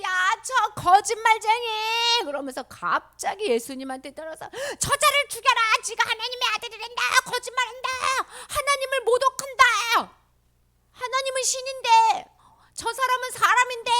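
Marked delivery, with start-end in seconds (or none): 0.0-1.7 high, loud wailing jeer; accusing crowd. Acted. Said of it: "그러니까 사람들이". 4.5-13.9 very high-pitched mob outcry; angry crowd. Acted. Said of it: "막 이러면서 막 화를 내면서".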